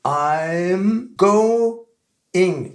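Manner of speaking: "I'm going" stretched and split as "I'm go-ing"; slow, rising "I'm going", each sound held; drawn-out syllables. Shown as a demonstration. Teacher building the phrase sound by sound, drawn out. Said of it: "Donc, ça fait".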